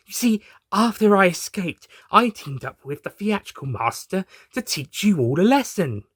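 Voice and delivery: high, smug villain voice; the Rani boasting to the doctors; gloating villainess. Acted. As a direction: lay out the evil plan with relish. Rani villain explaining her scheme.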